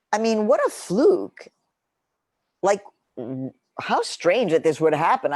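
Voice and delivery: high, loud, baffled questioning; incredulous musing. Retold or imitated. Retelling to her editor how odd it seemed, incredulous. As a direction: toss it off like a bizarre fluke. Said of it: "I was like".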